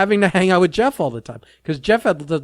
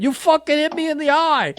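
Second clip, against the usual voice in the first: put-on thick Boston accent, much higher; 10 semitones higher than usual. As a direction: imitate Kevin's Boston accent with a raised pitch. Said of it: "with that Boston accent".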